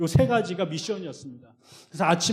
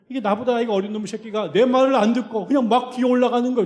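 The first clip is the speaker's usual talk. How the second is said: heated, louder and higher complaint; fuming about the disobedient guy; angry rant; 6 semitones higher, 8 dB louder than usual. Retold or imitated. Pastor fuming to congregation, loud heated rant.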